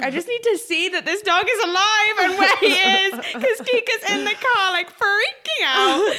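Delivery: high, hammed-up panicky pleading; frantic plea. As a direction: plead in a high voice, half laughing.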